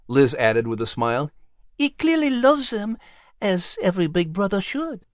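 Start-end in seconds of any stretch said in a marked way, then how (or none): 1.8-5.0 much higher, altered lady's voice; a fond lady asking for agreement; fond lady Liz. Acted. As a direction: Liz praising Patrick to Nigel. Liz continues to Nigel.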